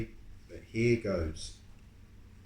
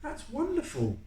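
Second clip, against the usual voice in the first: teacher's praise; 14 semitones higher than usual. Acted. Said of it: "says Missus Singh".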